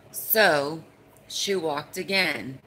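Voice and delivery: ordinary talk, nothing marked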